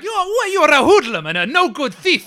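high, loud furious accusation; angry shout. Acted. Vendor shouting at the boy, furious. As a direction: yell it like catching a thief. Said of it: "he's suddenly angry".

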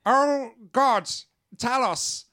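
high, loud awed creature voice; groveling creature. Acted. Fish creature groveling to the player's fake god, high and awed. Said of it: "and says".

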